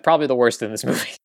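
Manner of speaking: ordinary delivery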